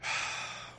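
long audible sigh; a slow unvoiced exhale after being told to take a breath; deep breath out. Real, not acted. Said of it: "Take a breath".

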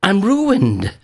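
high, drawn-out wail of doom; mock crying out. Preacher acting Isaiah overwhelmed at seeing God, mock crying out. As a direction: cry out in exaggerated despair. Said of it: "by crying out".

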